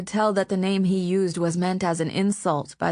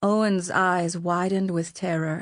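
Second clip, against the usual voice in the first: usual speaking voice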